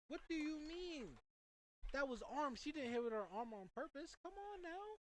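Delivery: high, indignant protest at the ref. Real, not acted. Commentator protesting a handball call, high and indignant. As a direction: complain up at the referee, outraged.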